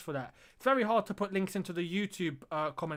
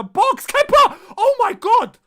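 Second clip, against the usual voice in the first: much higher and louder yelling the keeper's name; panicked shout; 17 dB louder, slower than usual. Real, not acted. Streamer yells at Kepa as Morecambe cross, alarmed shout. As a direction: scream in alarm as the ball goes in the box. Near own goal by Kepa.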